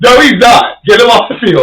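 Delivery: high, loud teammate overruling him; mock stern order. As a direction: bark it like the coach who ends your day.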